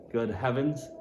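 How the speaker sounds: ordinary delivery, nothing marked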